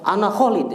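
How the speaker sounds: "ana Khalid" stopped on the d, no ending; dropped final vowel. Shown as a demonstration. Teacher showing what beginners shouldn't do, ending dropped. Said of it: "jangan, baca aja ujungnya".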